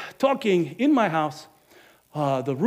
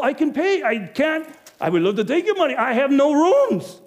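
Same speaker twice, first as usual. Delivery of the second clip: loud, high insistence; exasperated; 10 semitones higher, 8 dB louder than usual. Acted. Innkeeper loudly insists to Joseph every room is filled. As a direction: hammer home that there's nothing left.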